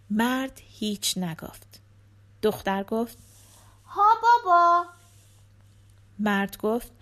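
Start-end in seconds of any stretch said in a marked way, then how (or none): 3.9-4.9 put-on high-pitched little girl chatting to her dad; little daughter. A narrator's reading. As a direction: voice a young daughter, pitched well up. Narrator voices the daughter. (صدای زیر دختربچه)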